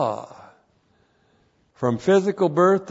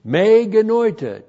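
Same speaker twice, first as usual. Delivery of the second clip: louder, higher demonstration; emphatic; 8 semitones higher, 6 dB louder than usual. Shown as a demonstration. Teacher telling class to listen for theos, emphatic. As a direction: ring out the Greek phrase forcefully. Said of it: "very emphatic".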